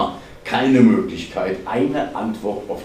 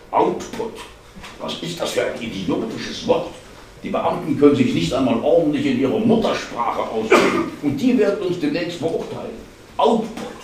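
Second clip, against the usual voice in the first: high-pitched sarcastic outburst, close to cracking; loaded with sarcasm; 5 semitones higher, 3 dB louder than usual. A narrator's reading. Colleague railing at officials' jargon, sarcastic. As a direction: sneer it with the voice rising towards breaking. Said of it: "Seine Stimme war geladen mit Sarkasmus und stand kurz davor, sich zu überschlagen".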